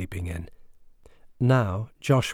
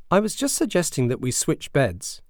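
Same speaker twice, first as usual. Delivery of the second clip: innocently; 6 semitones higher than usual. Acted. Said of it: "said innocently".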